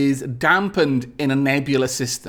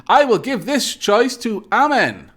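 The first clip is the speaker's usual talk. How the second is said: ordinary talk, nothing marked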